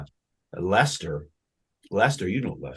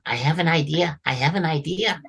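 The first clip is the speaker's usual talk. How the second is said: a hint of an excited helper; lightly voiced eager elf; 6 semitones higher than usual. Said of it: "he talks like that".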